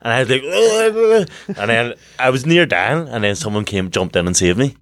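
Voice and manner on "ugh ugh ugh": high mock choking noises; playful, loose gurgling cries; imitated drowning gurgle. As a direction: imitate a kid going under water. Imitating himself drowning as a child.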